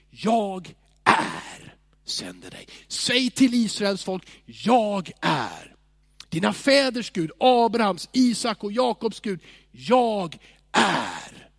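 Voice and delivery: slow, grand voice of God at the start; solemn voice of God. Retold or imitated. God speaking to Moses, slow and solemn. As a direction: proclaim it like God naming himself. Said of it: "Gud säger till Mose".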